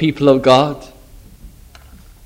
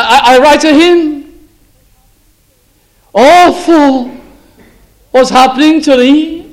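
mock pitying onlooker; 10 semitones higher, 5 dB louder than usual. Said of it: "You don't go up to him and say".

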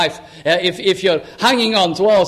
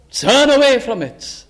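higher, urgent command; forceful command; 5 semitones higher than usual. Acted. Apostle warning against pagan filth, forceful high command. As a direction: drive it home with raised pitch. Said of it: "He's speaking like that".

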